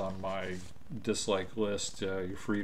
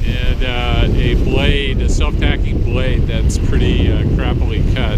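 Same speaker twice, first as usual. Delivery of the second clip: shouting over wind; 4 semitones higher, 20 dB louder than usual. Real, not acted. Talking on deck in wind.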